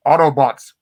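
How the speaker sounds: mock heroic rallying shout; Optimus calling out. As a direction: summon the team like a leader in a crisis. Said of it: "while Optimus is like".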